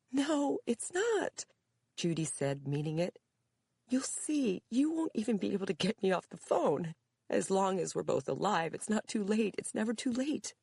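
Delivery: high, emotional, insistent plea; pleading daughter. A narrator's reading. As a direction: insist through tears.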